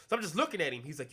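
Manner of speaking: ordinary talk, nothing marked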